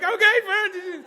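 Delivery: very high, loud, slow squeaked reply; squeaky okay. Retold or imitated. Ten-year-old agreeing to stop, slow high squeak.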